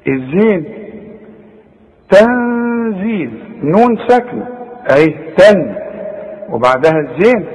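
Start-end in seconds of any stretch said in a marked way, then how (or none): 2.1-3.4 تنزيل slowly with a nasal hidden ن; slow demo. Shown as a demonstration. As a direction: stretch تنزيل, humming the ن. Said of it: "أغن واخفي".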